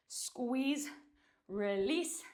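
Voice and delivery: ordinary delivery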